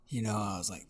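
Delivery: normal talking voice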